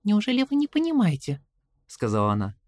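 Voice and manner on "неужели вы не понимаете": put-on worried young girl, higher; anxious little girl asking; girl's voice. A narrator's reading. Caroline objects. (голос встревоженной девочки)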